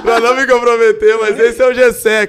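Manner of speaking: high, loud, through real laughter; laughing protest. Real, not acted. Husband dodges saying the pet's name, laughing loudly. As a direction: protest loudly while cracking up. Laughing over naming his pet.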